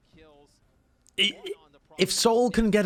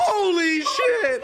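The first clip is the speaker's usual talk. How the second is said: high, slow, laughing holy shit; stunned laughing; much slower than usual. Real, not acted. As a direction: stretch it out in awe. Stunned by the play.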